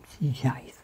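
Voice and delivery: soft doubtful whisper. Real, not acted. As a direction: whisper it half to yourself. (chuchoté)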